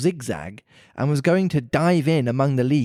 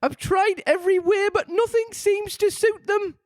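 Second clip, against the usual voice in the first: pigeon with a sob. A narrator's reading. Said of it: "added with a kind of sob".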